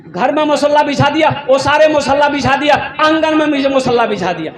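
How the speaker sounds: high-pitched, driving preacher's list; rhythmic preaching. Acted. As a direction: hammer out each place in a chanting rhythm. Preacher lists with raised voice.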